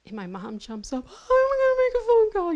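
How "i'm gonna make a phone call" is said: high, loud, near-crying panic; a frightened mother fleeing the room in tears; terrified mother. Retold or imitated. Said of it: "just, like, terrified".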